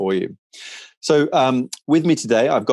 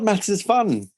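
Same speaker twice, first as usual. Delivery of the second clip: high, drawn-out teasing insistence with a falling end; playful insistence; 11 semitones higher, slower than usual. Acted. Presenter teasing colleague who called maths a nightmare, playfully insistent. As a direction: cheerfully defend maths, stretching fun.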